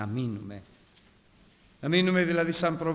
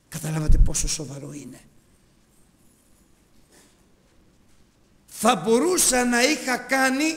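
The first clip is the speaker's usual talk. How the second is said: fervent preaching; 9 semitones higher, 11 dB louder than usual. Acted. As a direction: drive the point home to the congregation. Preaching at full force. (δυνατό κήρυγμα με έμφαση)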